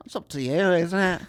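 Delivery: high, loud Scottish brogue. Pretend. Co-host asks for the impression, so a loud Scottish brogue. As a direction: do the Scottish brogue on request, hammed up. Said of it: "Do it".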